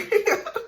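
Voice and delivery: a sudden high burst of giggling; high giggle. Real, not acted. Remembering laughing with her best friend in biology, a high giggle. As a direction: giggle at the memory.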